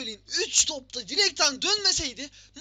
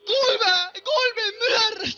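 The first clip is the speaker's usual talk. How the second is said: loud yelling goal cheer, voice cracking; goal celebration; 4 semitones higher, 5 dB louder, slower than usual. Real, not acted. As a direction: roar it out like the ball just went in. Goal scored in the match. (gol sevinci çığlığı)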